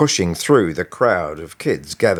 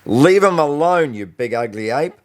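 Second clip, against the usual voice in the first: higher, louder furious command; understated fury aimed at a bully; furious thought; 7 semitones higher, 3 dB louder than usual. A narrator's reading. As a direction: hurl the words at the bully's head. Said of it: "thought furiously".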